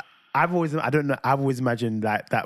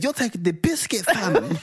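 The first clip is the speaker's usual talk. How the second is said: put-on higher, cheeky little boy copying a scold; child mimicking parent; 7 semitones higher than usual. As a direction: parrot the grown-up telling-off. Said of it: "you hear Ivan go to Mateus".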